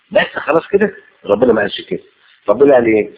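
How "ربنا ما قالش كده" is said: normal talking voice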